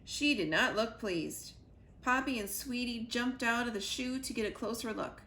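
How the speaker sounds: ordinary delivery, nothing marked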